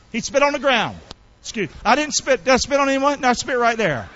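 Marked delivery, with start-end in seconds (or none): none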